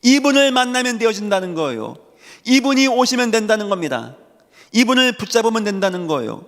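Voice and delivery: high-pitched, insistent sermon cadence; emphatic preaching. Real, not acted. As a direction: launch each sentence high, let it fall. Preaches at full force. (높은 음으로 힘주어 선포하는 설교조)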